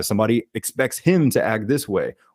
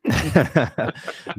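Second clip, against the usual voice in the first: a quick real laugh at the question; short amused chuckle; 5 semitones higher than usual. Real, not acted. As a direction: laugh it off, caught by surprise.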